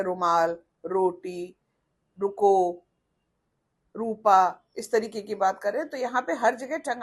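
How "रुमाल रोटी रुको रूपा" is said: रुमाल, रोटी with a tongue tap; Hindi tapped R. Shown as a demonstration. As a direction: vibrate the tongue on र in रुको. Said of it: "Hindi में जैसे बोल रहे हैं".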